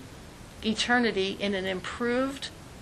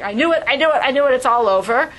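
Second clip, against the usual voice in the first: higher and much louder, in alarm; hammed-up baby terror; panicked twin; 4 semitones higher, 9 dB louder, faster than usual. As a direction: panic that it's all over. Twin panicking as brother is born.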